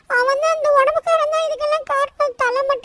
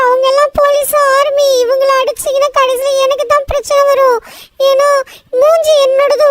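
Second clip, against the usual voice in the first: much louder, yelling and begging; shouting plea; 8 dB louder than usual. Acted. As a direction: shout up at the giant to stop. Shouting pleas at Hulk.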